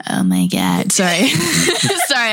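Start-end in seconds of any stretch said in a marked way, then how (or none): 0.1-0.9 groggy just-woke-up voice; croaky morning voice; voice cracking. Real, not acted. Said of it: "I sounded like ... I just woke up".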